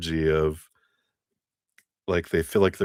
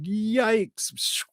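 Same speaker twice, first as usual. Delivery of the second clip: high startled yikes; mock fright. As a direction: recoil in playful alarm. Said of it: "it's like".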